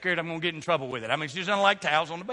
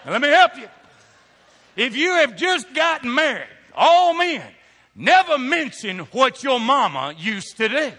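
louder and higher, warning the men; loud preaching; 4 semitones higher, 8 dB louder than usual. Acted. Pastor warns the newly married men, loud preaching. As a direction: preach it like urgent advice.

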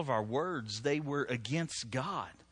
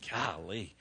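imitating Keith; 8 semitones lower, 6 dB quieter than usual. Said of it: "I'm like Keith when he's got the choir".

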